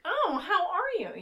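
high, slow, overly friendly greeting; chirpy American tourist. Retold or imitated. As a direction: gush like an eager tourist. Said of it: "Americans would always be like".

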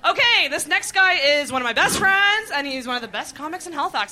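loud, high club emcee hype; MC introduction. Real, not acted. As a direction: build the crowd up, then shout his name. Comedy host introduces Andrew.

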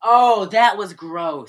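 slow, loud drawn-out disgust; grossed out. Real, not acted. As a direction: stretch it out, disgusted.

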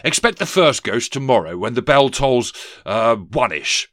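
loud pronouncement sagging into hesitation; almost apologetic ghost. A narrator's reading. Ghost schedules visits, mildly apologetic bluster. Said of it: "he said, almost apologetically".

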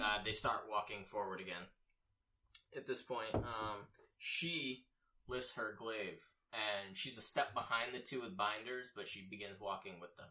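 normal talking voice

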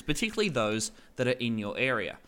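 ordinary talk

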